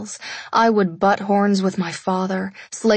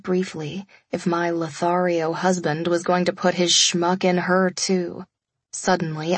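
usual speaking voice

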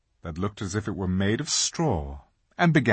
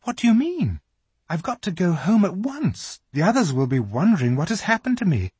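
put-on higher, hurried worried girl; frightened; 8 semitones higher, faster than usual. A narrator's reading. Lucy anxious to get home, frightened and hurried. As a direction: rush it out anxiously. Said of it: "feeling rather frightened".

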